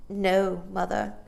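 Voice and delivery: plain reading voice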